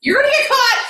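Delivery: high, excited cry to a book character; mock panicked warning. Reader warns a character in the book, excited high cry. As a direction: call out a playful warning, pitch way up.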